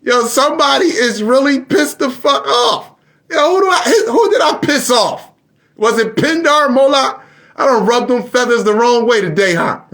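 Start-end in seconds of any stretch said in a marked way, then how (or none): 0.0-2.9 high voice laughing through it; laughing disbelief. Real, not acted. Streamer to viewers, high laughing disbelief. 3.3-7.2 much higher, excited questions; excited wondering. Real, not acted. Streamer asking viewers who he angered, high excited.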